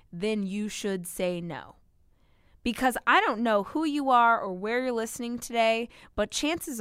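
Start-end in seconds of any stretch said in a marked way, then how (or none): none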